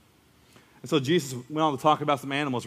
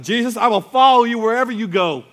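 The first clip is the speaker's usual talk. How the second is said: high, loud, eager follower gushing; a fan who can't wait to join in; eager blurting; 6 semitones higher, 5 dB louder than usual. Acted. Disciple blurting to Jesus, eager and loud. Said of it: "He blurts out".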